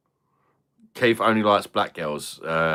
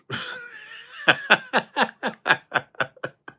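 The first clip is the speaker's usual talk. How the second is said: very high shrieking laugh; squealing laugh. Real, not acted. High squealing laugh after joke.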